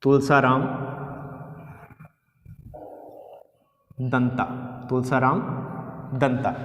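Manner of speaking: Tulsaram दंता spaced out and repeated; word-by-word dictation; slow dictation. Shown as a demonstration. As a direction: dictate slowly for writing. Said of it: "लिख लो".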